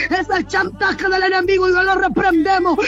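ordinary talk

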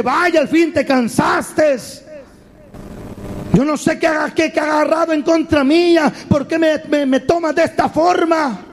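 high, indignant grumbling; acted aggrieved protest; complaining clay. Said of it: "aquel barro vivía quejándose".

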